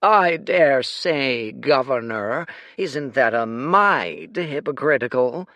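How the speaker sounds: put-on very slow drawling old dandy; reedy drawl. The plump old man mocking the governor, a slow reedy drawl. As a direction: drawl it out, amused. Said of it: "His voice was a reedy drawl".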